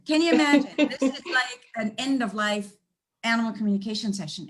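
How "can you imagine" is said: louder, higher, fully amazed; emphatic repeat. Real, not acted. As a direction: hammer it home with amazement.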